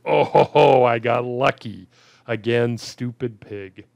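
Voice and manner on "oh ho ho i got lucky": gleeful relief. Real, not acted. Lucky escape in the game.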